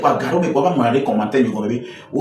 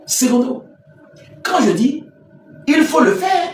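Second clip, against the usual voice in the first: monte très haut et crie; lance-le en criant plus fort, plus haut; cri insistant; 13 semitones higher than usual. Real, not acted. Insiste avec force. (crie pour réveiller l'honorable)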